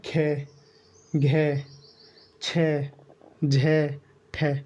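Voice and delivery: खे, घे, छे, झे, ठे each said with a strong breath of air; aspirated demo. Shown as a demonstration. Teacher shows students breathy aspirated consonants. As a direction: push extra air out on खे, घे, छे, झे, ठे. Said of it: "बोल के देखिए".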